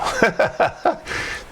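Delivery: sudden high airless giggle; amused wheezy laugh; high breathy laugh. Real, not acted. Laughing at terrible customer service.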